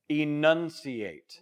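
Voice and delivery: very slow, syllable-by-syllable articulation; enunciated. Shown as a demonstration. Teacher reminding trainee what was said before, slow and enunciated. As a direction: stretch the word, every sound crisp. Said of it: "remember what I said before".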